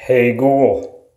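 usual speaking voice

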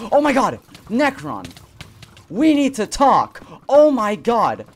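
loud and high, panicked; frantic yelling. Real, not acted. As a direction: yell it in panic. Panicked shouting mid fight.

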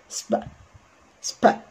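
"سب" run together as one sp sound, no vowel before it; blended as one sound. Shown as a demonstration. Teacher showing two consonants said together, "سب" blended as one sound. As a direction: blend s straight into p on "سب". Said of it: "يتنطقوا كانهم ساوند واحد".

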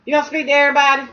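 quick playful nudge to the dog; playful pet talk. Real, not acted. Owner teasing dog to greet.

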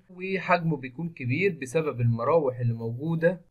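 usual speaking voice

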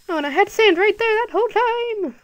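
high, loud surprised outburst; a sudden high-pitched realization; voice went high. Real, not acted. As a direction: squeak it out, caught off guard. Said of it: "Sorry, my voice just got high".

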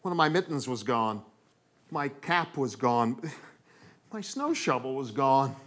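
ordinary delivery, nothing marked

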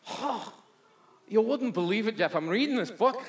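hammed-up, high and loud bad Newfie accent; Eli in mock Newfie. Parishioner telling pastor about his reading, badly faked Newfie accent. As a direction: botch a Newfie accent with excited, lilting pitch. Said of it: "I know that's not Newfie".

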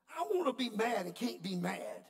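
preacher confessing to the church, loud and exasperated; loud, high exasperation. Real, not acted. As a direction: cry out the frustration, loud and high. Preacher's frustrated punchline.

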